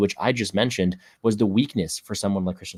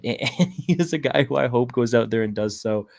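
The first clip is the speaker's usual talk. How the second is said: ordinary talk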